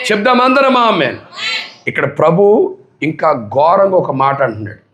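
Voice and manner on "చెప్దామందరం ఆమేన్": shouted amen call. Real, not acted. Preacher repeating the amen call as a full shout to the congregation. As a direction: cry out "చెప్దామందరం ఆమేన్" at the top of the voice.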